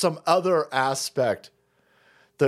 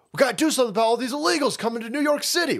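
higher, fast mock politician; a hammed-up caricature of an official; 9 semitones higher, faster than usual. New York officials on illegals, in a mock politician voice.

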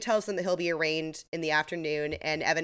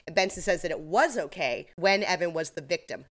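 normal talking voice

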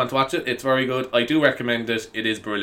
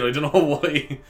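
ordinary talk, nothing marked

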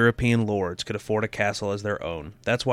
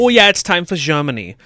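high, louder fake German; cheerful put-on German, pitched up and louder; mock German accent; 7 semitones higher, 8 dB louder than usual. Host redoes the Germany intro in high mock German. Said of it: "Two voices".

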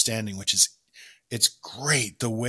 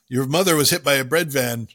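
raised and louder, rushing the second half; mock dramatic announcement; 3 semitones higher, 5 dB louder, faster than usual. Podcaster retelling a film line to co-host, mock alarm. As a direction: blurt out the bad news in mock alarm.

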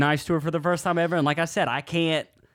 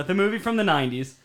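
ordinary delivery

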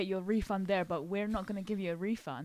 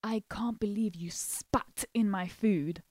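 hushed, breathy accusing whisper; retold whispered accusation. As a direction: whisper it like an angry secret. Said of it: "she's like".

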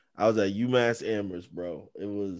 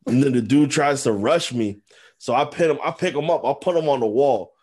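normal talking voice

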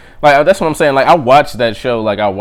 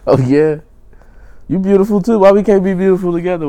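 flirty; 7 semitones higher, 4 dB louder than usual. Pretend. Flirting back at a woman on screen.

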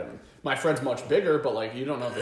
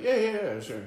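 eager agreeing; 10 semitones higher, 3 dB louder, faster than usual. Retold or imitated. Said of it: "My buddy's like".

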